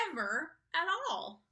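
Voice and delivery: plain reading voice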